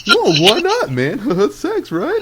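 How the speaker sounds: high, loud, laughing imitation; mock baffled protest. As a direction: protest like an overeager buddy. Mimics friend's baffled, eager protest.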